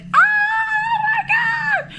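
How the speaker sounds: very high, loud, stretched shriek; drawn-out delighted shriek; ecstatic scream. Retold or imitated. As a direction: scream with sheer joy. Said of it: "from a miserable ... to".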